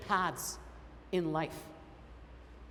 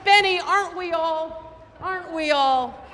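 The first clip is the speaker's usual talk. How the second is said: playful retort; 7 semitones higher, 6 dB louder than usual. Retold or imitated. Joking reply to Benny.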